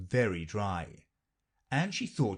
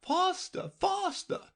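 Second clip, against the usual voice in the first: queen crying out; slower than usual. A narrator's reading. Queen urges Alice while running, high mild cry. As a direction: hurry her along in a high queen voice. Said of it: "the queen kept crying".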